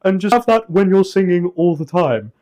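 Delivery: yawn-shaped voice. Shown as a demonstration. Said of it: "one of the easy ways is to do a yawn".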